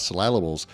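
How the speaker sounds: SYLLABLES with the stress wrongly on the second syllable, sil-LAB-les; wrong emphasis. Shown as a demonstration. Host joking about a long track name, syllables misstressed as sil-LAB-les. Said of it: "put the wrong emphasis".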